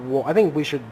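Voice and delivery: usual speaking voice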